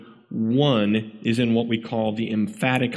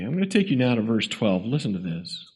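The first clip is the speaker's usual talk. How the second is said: usual speaking voice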